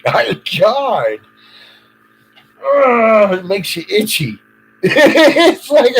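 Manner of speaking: high, loud, half laughing, half crying; real exasperation spilling over into laughter; near-hysterical laughing disbelief; real disbelieving laugh-cry. Real, not acted. Overwhelmed outburst at the cops.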